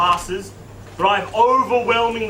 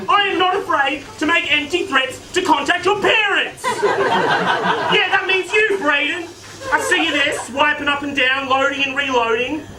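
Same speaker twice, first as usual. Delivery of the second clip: high, loud scolding principal; raised voice; 8 semitones higher, 3 dB louder than usual. Acted. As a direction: raise your voice and threaten the unruly students. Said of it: "forced to raise my voice".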